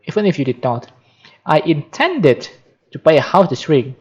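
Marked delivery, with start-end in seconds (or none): 1.4-4.0 "intended" stretched out, then a pause; emphasize "intended", drawing it out; stress on INTENDED. Shown as a demonstration. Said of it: "to supplement the emphatic words".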